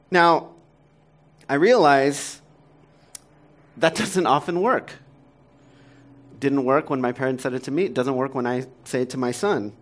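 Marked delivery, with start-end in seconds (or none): none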